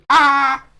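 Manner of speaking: abrupt loud, high "Ah!"; sudden startling yell. Retold or imitated. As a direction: burst out with a sudden startling cry. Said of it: "all you hear is".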